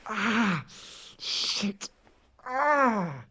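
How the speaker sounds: high strained cries of pain; pained outcries. Acted. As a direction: gasp and cry out from the blow. Cries from head injury.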